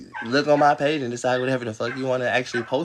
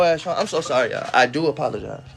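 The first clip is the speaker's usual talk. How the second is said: ordinary talk, nothing marked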